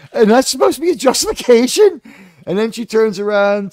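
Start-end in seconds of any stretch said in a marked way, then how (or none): 0.1-2.0 much higher and louder, in disbelief; incredulous. Real, not acted. Reviewer scoffing at Yelena's excuse, high and incredulous. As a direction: throw the question up in outrage.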